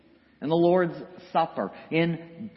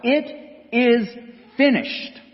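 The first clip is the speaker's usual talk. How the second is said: raised, emphatic proclamation; calling out; 8 semitones higher, 3 dB louder, slower than usual. Acted. The shepherd's voice proclaims it is done, calling out. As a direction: call it out higher, each part set apart. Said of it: "a voice that calls out".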